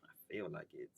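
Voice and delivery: low whispery croak with no voice; voice gone out. Real, not acted. Co-host joking about puberty part two, voice gone out. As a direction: barely get it out, voice gone. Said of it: "My voice went out again".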